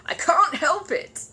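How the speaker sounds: bursting out laughing. Acted. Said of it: "bursting out".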